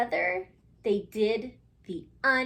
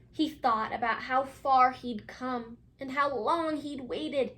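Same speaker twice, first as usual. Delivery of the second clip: storybook reading; 2 semitones higher than usual. A narrator's reading. Teacher reading a picture book to her class, gently. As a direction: read it like bedtime to little ones.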